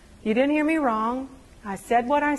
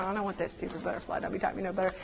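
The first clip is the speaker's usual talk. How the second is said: sulky grumble; 4 semitones lower, 11 dB quieter, much faster than usual. Retold or imitated. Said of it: "'cause I'm going".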